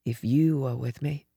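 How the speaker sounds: soft, low question, half asleep; drowsy, hushed musing; drowsy thought. A narrator's reading. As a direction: murmur it sleepily to yourself. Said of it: "I thought drowsily".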